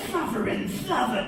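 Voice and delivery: mock cartoon cat voice; Sylvester the cat. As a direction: sputter it like the Looney Tunes cat. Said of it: "his name was Sylvester".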